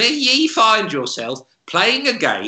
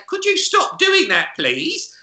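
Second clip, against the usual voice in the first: mock shop assistant; 10 semitones higher than usual. Shop staff voiced in curse story.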